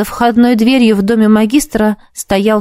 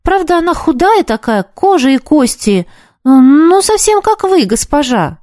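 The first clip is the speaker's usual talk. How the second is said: put-on higher, lively young boy; 7 semitones higher than usual. A narrator's reading. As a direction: voice the chatty street boy. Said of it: "продолжал мальчик".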